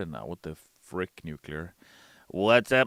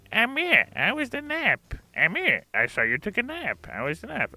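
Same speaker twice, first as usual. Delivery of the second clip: much higher, singsong teasing; teasing high voices; 6 dB louder than usual. Retold or imitated. Friends pestering him about his nap, high and teasing. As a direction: tease him like nosy friends. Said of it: "everyone was like".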